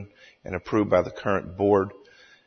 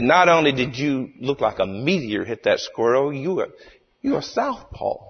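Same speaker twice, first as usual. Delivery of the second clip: amazed, higher-pitched mock-Cajun voice; Cajun storyteller; 5 semitones higher than usual. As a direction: marvel at it in a hammed-up Cajun voice. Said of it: "tells the story in his hilarious Cajun accent".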